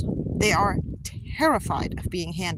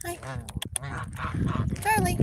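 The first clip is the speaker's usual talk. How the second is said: high sing-song calling of a name; high coaxing call; 11 semitones higher, 6 dB quieter than usual. Real, not acted. Owner calling her goose Charlie in high baby talk. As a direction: call him over up high and sweet.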